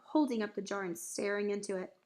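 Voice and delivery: ordinary delivery, nothing marked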